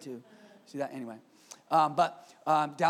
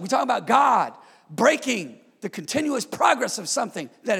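higher, louder, hammering repetition; preaching at full force; 5 semitones higher, 8 dB louder than usual. Acted. Pastor preaches divine interruption to the church at full force. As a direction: drive each "To break" home.

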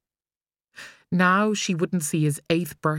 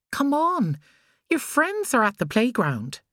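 higher, lightly voiced mother coaxing; mother's voice; 8 semitones higher than usual. A narrator's reading. Mother coaxing her son back to play, light. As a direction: coax him along gently.

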